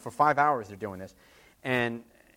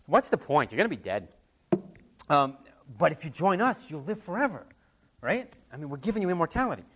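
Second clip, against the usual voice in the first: higher, coaxing recruiter voice; 4 semitones higher than usual. Retold or imitated. Recruiters selling immortality, coaxing and higher. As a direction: sell eternal life sweetly.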